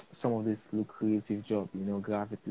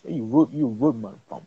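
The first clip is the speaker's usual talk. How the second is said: louder, playful British roadman imitation; mock British; 8 dB louder than usual. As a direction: tease with mock British street slang.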